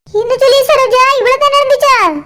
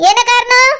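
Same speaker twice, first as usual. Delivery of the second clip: ordinary talk, nothing marked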